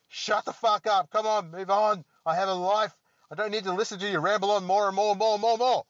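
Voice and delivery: loud, high, fast ranting at the listener; hammed-up fed-up outburst, speeding up; half-joking yell at a rambling host; mock yell. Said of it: "Sorry if I yelled you too, too much".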